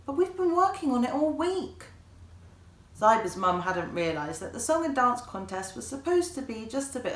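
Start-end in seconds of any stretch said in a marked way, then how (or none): 0.1-1.8 high, pleading protest; dismayed protest. A narrator's reading. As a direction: mum can't believe the refusal. Mum protesting, disappointed.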